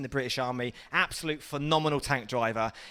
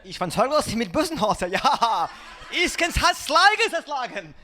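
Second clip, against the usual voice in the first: loud, higher-pitched mock German; 8 semitones higher, 5 dB louder than usual. Comic changing styles to do it in German, loud mock German. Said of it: "Let's do this in German".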